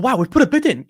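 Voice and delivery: high, mock-amazed fan voice. Streamer mocking fans surprised at the bid, a high mock-amazed voice. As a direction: parody the gasp of naive fans. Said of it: "people are like".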